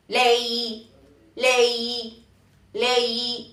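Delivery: "lei" held long each time with a slight bend; curved, prolonged tone. Shown as a demonstration. Teacher drilling the Cantonese tone, prolonged "lei". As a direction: repeat "lei" as a stretched model. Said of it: "y se prolonga".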